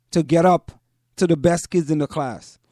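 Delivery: normal talking voice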